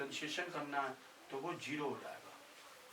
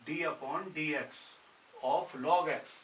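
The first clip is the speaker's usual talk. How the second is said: slow dictation; 5 dB louder, much slower than usual. Shown as a demonstration. Dictating formula slowly.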